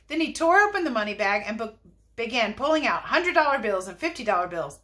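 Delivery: ordinary delivery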